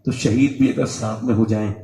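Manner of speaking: put-on young boy begging his older brother not to throw him; boy's soft plea. Boy softly pleads with brother.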